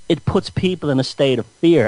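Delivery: normal talking voice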